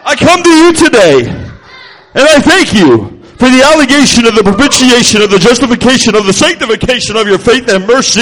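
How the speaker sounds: loud, pompous preacher parody; hammed-up big-word prayer with stretched endings; mock fancy praying. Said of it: "sometimes we think we gotta be like".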